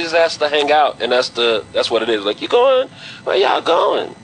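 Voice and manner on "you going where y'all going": put-on girl voice. Imitating girls asking to hang out.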